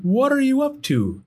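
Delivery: high, anxious question; worried calling. Acted. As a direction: call out in concern. Sam calling after Gus.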